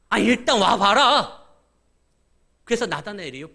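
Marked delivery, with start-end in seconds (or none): 0.1-1.4 loud, drawn-out urging to come; insistent urging. Acted. Philip urging doubtful Nathanael to come and see, loud and insistent. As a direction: stretch it out loudly, brushing the doubt aside.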